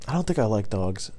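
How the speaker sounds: ordinary talk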